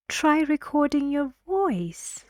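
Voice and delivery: friendly suggestion with "voice" going up high; suggestion intonation. Shown as a demonstration. Teacher demonstrates a suggestion to students, friendly rising intonation. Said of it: "I'm giving you a suggestion".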